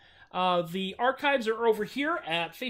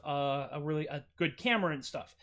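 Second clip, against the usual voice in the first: ordinary talk, nothing marked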